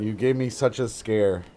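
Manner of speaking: normal talking voice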